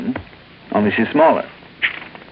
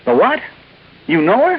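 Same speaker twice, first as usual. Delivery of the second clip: much higher, quick astonished questions; startled surprise; 13 semitones higher than usual. Acted. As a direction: caught off guard by the news. Learns Randy knows the girl.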